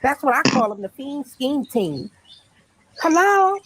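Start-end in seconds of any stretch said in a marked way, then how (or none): 3.0-3.6 higher, dramatic innocent woman; high put-on voice. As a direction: play the startled suspect. Acting arrest skit.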